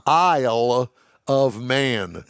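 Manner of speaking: "Isle of Man" drawn out with pauses between words; careful pronunciation. Shown as a demonstration. Host correcting co-host's Texas accent, slow careful pronunciation. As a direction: spell out "Isle ... of Man" slowly, word by word. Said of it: "I think what you're saying is".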